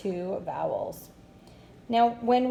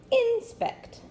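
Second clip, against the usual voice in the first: "Inspect." with IN high and strong; stress on first syllable. Shown as a demonstration. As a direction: hit IN in "Inspect.", let spect fall away. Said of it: "put the accent on the first syllable".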